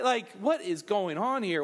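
ordinary talk, nothing marked

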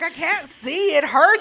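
whiny voice. Retold or imitated. Retelling burning eyes.

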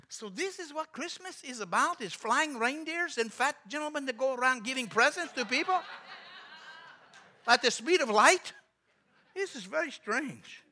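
put-on high, incredulous Russian-accented English; broken English. Visitor baffled by flying reindeer, broken English accent. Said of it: "he spoke real broken English".

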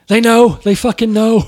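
high, loud, near-tearful shout; panicked shout. Retold or imitated. Drummer shouting to the band that cops know, tearful panic. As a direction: wail it like everything is lost. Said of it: "And he's like".

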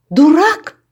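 high, loud incredulous cry of Дурак? rising to the top; shocked cry. A narrator's reading. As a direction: shriek Дурак? in disbelief. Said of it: "скрикнула Янка".

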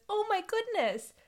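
high-pitched little owlet; small high storybook animal voice; baby owl. Acted. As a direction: pitch it up like a startled chick. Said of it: "And one says".

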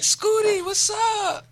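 very high mock girl voice; high girly greeting. Retells girl's greeting.

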